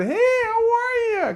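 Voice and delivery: very high, slow, drawn-out greeting; high fake phone tone. Shown as a demonstration. Telemarketer greeting a prospect in a very high slow tone. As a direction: stretch the hello way up like a pushy caller. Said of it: "kind of tone?".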